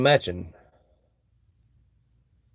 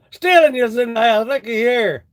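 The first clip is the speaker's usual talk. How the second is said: mock hype; 6 semitones higher, 5 dB louder than usual. Mock hype shout to viewer.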